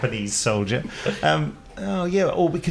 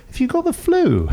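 much higher, louder squeal of a teasing wife, laughing; mocking wife; 5 dB louder than usual. Wife teasing her husband mid-romance, a high mock squeal. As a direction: play the wife asking if he's got the flu. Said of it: "she doesn't say".

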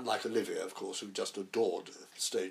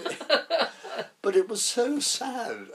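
laughing; 13 semitones higher, 8 dB louder, much slower than usual. Real, not acted. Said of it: "we were pissing ourselves".

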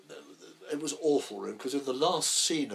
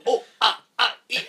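fight grunts; 13 semitones higher, 14 dB louder than usual. Retold or imitated. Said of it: "And I go".

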